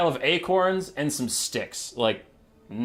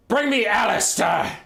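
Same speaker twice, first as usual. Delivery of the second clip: king's command; 6 semitones higher, 3 dB louder than usual. Acted. Dying king demands his general.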